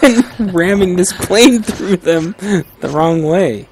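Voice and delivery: drawn out with laughter; slow laughing threat. Pretend. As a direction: threaten slowly, half laughing. Mock pilot threatening passengers.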